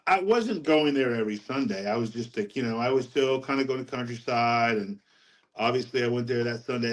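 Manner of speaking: ordinary delivery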